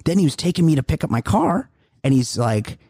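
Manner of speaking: ordinary talk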